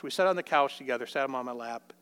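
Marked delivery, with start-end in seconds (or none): none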